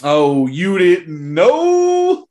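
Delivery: slow, loud, drawn-out ring announcer; ringmaster announcer. Acted. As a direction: boom it out like a ringmaster. Announcing his pick grandly.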